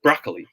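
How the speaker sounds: broccoli as BRAHK-lee, falling, middle swallowed; unstressed CO in broccoli. Shown as a demonstration. Teacher confirming the answer, broccoli as BRAHK-lee with unstressed middle. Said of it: "hindi siya emphasized".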